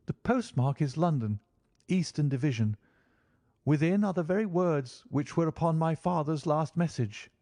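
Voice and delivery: a hint of a worried visitor pleading his case; troubled young client. A narrator's reading. Young client explaining his troubles to Holmes, lightly worried voice.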